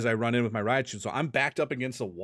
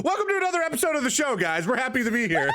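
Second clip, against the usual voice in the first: high, fast hammed-up podcast host; show host voice; 13 semitones higher than usual. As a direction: announce it like a TV host. Mock show host intro.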